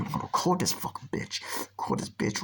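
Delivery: whisper. Retold or imitated. Friend whispering to himself.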